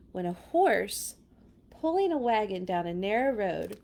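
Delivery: ordinary delivery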